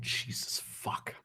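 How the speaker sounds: breathy exasperated whisper. Real, not acted. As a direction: mutter it under your breath in disbelief. Exasperated aside.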